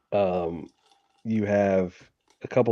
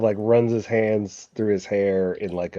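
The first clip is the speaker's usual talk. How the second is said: ordinary talk, nothing marked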